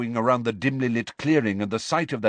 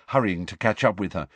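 ordinary delivery

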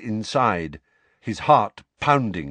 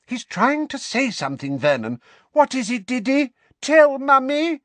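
coaxing shrill mother; 5 dB louder than usual. Acted. Petunia coaxing Dudley.

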